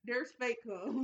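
slow disbelief. Real, not acted. Cohost repeating the news about fake cum, slow disbelief. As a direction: let it sink in, stretched. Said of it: "Yeah, it's like".

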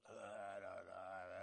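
very low, soft sick groan; covid raspy voice. Retold or imitated. Pastor imitating his sick brother on a call, raspy covid voice. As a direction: imitate a raspy invalid mumbling feebly. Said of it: "you have that covid raspy voice".

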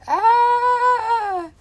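sudden high, loud yelp of fright; startled shriek. Real, not acted. Gamer startled by something on screen, high loud shriek. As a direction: shriek as if jumped at.

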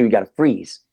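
ordinary talk

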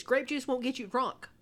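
usual speaking voice

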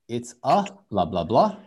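normal talking voice

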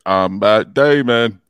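slow, loud cartoon movie voice; Matt Damon puppet impression. Imitating an animated movie for co-hosts, loud drawn-out puppet voice. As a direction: draw each word out, loud and slow. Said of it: "animated movie where he's like".

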